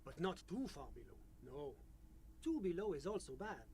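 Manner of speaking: put-on French-accented official; measured, polite French accent. GM voices official NPC.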